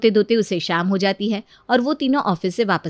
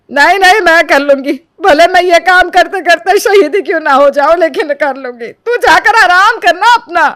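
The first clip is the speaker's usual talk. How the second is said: loud, high indignant wail; martyr outburst; 9 semitones higher, 10 dB louder than usual. Acted. Mother-in-law loudly plays martyr about the mopping. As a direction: shout like a wronged martyr.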